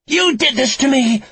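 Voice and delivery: high, loud accusing scream. A narrator's reading. Lucy blames Frankie amid the chaos, screaming. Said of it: "Lucy screamed again at Frankie".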